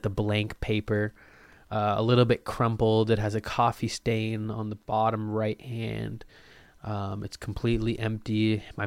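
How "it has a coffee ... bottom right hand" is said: ordinary talk, nothing marked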